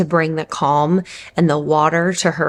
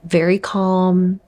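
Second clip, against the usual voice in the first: slow, soothing calm voice; slow and gentle, reassuring; 2 semitones higher, much slower than usual. Shown as a demonstration. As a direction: show how calm you kept it. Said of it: "my voice was just like this".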